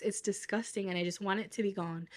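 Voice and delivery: normal talking voice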